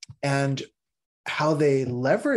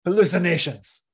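dismissive teacher; 5 semitones higher, 3 dB louder than usual. Retold or imitated. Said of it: "the teacher will be like".

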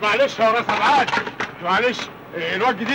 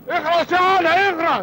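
angry shout; 6 semitones higher than usual. Acted. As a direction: shut him up. Uncle shouting at Ali. (الخال يزعق لعلي بغضب)

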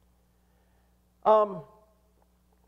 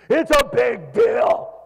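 loud, drawn-out overblown shout; mock outraged shout; 4 semitones higher, 7 dB louder, slower than usual. Preacher mimicking someone fussing over little faults, mock outraged shout. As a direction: blow it up into a huge fuss. Mocks the one making a fuss.

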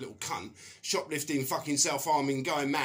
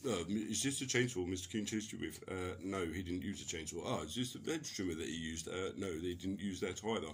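lightly voiced questions and answers, much softer; hushed court exchange; 7 semitones lower, 9 dB quieter than usual. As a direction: murmur the courtroom Q and A under your breath.

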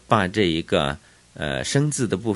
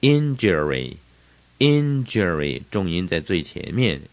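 Injury slowly, strong IN; stress at the front; 2 semitones lower than usual. Shown as a demonstration. Teacher drilling new words, Injury stressed on IN. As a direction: hit IN in Injury, then let it fall.

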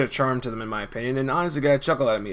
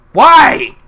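loud high incredulous Why; exasperated shout; 14 dB louder than usual. Real, not acted. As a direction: shout it in disbelief. Exasperated complaint about missing character.

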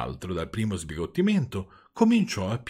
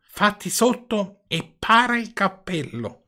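high, drawn-out call in a character voice; old man's shout; 10 semitones higher, 4 dB louder, slower than usual. A narrator's reading. Old man calls to Pinocchio from the window, slow high shout. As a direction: call down slowly, pitched well up. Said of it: "vecchino gridò a Pinocchio".

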